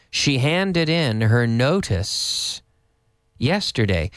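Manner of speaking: very slow "She handed in her notice yesterday.". Shown as a demonstration. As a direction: stretch it out for learners.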